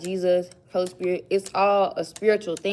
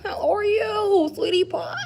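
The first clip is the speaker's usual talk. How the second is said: high, slow sweet greeting; imitating her again; 10 semitones higher, much slower than usual. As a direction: coo it like a sweet lady. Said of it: "She like".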